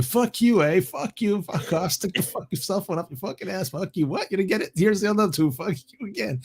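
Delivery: high-pitched mock Canadian swearing; comic Canadian-accented cursing. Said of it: "It's like typical Canadian".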